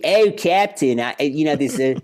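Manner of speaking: flustered Philly crewman reporting to the captain; hammed-up Philadelphia accent. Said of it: "they don't want anybody going".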